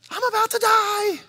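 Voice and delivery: high squeaky mock fear; mock panic. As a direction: squeal it like a frightened person. Panicked view quoted mockingly.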